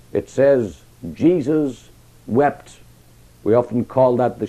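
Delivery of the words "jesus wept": very slow, every word weighed; slow solemn reading. Acted. Preacher reading the shortest verse to the congregation, very slow and solemn. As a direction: let the verse sink in slowly.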